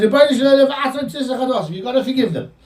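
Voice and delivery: high, urgent plea in a changed tone; impassioned demand. Retold or imitated. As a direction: plead insistently on the people's behalf, pitched well up. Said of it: "changes his tone completely".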